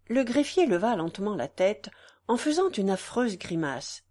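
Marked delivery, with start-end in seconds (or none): none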